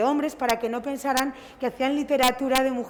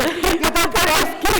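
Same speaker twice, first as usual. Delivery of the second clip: higher, louder exasperated retort; retold exasperated reply; 5 semitones higher, 6 dB louder, slower than usual. Author retelling her reply to a reader at the book fair, louder and exasperated. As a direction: toss it back, mock incredulous.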